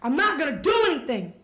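exasperated yell with swooping pitch; half-held acted scream of frustration; mock scream. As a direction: cry it out in embarrassed protest. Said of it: "I just scream in the middle of the courtyard".

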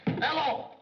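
loud, high bark into the phone; shouted hello. Acted. Secretary calling the exchange, shouted. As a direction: yell down the line.

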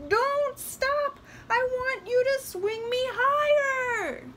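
high, loud, insistent doll voice; doll crying out. A narrator's reading. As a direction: demand it in a piercingly high cry. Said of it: "cried the doll".

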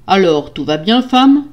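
demanding question rising on "femme"; stern husband. Acted. Husband checking on his wife, stern question. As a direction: ask sternly, then lift the last word.

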